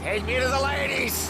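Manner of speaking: put-on high drunken call, repeated; drunk yelling. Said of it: "you're drunk".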